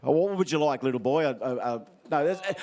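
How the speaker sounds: ordinary delivery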